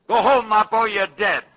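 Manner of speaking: loud, high-pitched yell across a hall. Retold or imitated. Herbie yells from the stage to Mappo, loud and high. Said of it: "and yells".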